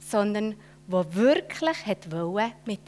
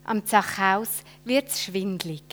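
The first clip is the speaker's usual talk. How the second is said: ordinary delivery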